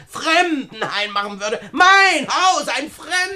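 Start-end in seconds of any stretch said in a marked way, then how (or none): 1.7-3.4 much higher and louder, sneering; mocking echo. [name] mocks Julia's hope of a guesthouse, high and sneering. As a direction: parrot her words back with scorn.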